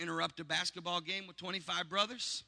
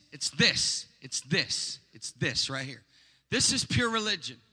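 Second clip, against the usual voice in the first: full-force preaching; 7 semitones higher, 16 dB louder than usual. Acted. Preacher stirring the congregation to visit the fatherless. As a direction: build it up and hammer every phrase.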